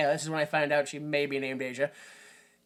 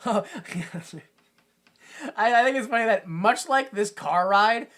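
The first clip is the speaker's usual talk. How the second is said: usual speaking voice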